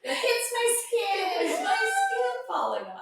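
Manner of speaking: high squeaky voice cracking with laughter; giggling high-pitched disgust; laughing squeak. Retold or imitated. Acted realization, high squeak.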